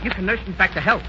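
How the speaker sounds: soft wooing. Acted. Sweet-talking the nurse.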